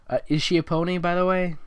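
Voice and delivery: usual speaking voice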